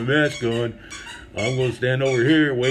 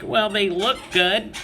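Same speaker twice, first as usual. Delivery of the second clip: higher, slow, chirpy imitation of the wife; higher sweet-toned wife, slowed; wife's upbeat take; 8 semitones higher, 3 dB louder, slower than usual. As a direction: lightly voice the optimistic wife. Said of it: "And the wife's like".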